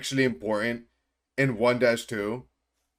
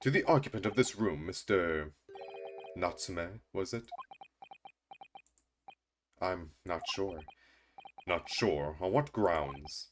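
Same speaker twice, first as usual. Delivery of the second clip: lower, softer reserved lodger; a hint of a shy, hesitant man; softly voiced tenant; quiet gentleman voice; 5 semitones lower, 8 dB quieter than usual.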